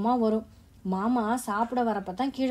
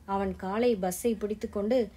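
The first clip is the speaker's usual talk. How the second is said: ordinary delivery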